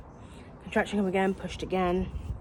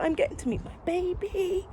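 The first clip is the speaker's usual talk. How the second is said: much higher, thrilled and eager; happy excitement; 12 semitones higher than usual. Retold or imitated. Retelling her joy about meeting the baby, high and excited. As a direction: let the joy lift the line up high. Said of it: "I was so happy".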